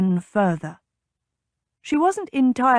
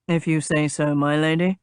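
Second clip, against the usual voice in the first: polite doubt; 4 semitones lower than usual. A narrator's reading. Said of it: "His voice held polite doubt".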